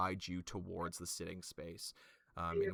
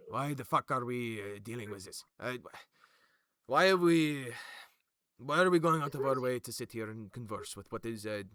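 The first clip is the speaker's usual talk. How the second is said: louder, higher, gruff complaint; grouchy old man; 5 semitones higher, 10 dB louder than usual. Acted. Sailor gripes to Rakora about wasting time. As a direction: grumble like a fed-up old soldier. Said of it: "As Sailor goes".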